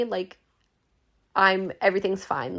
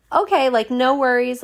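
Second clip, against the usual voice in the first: cheery; 5 semitones higher, 6 dB louder than usual. Retold or imitated. Cheerful reply to tax lady.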